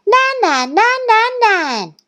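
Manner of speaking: very high, rapid-fire repeats; excited screaming. A narrator's reading. Thistle showing off to Goose, high screaming. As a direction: squeal it over and over.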